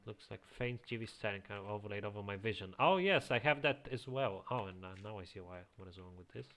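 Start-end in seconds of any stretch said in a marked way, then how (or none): none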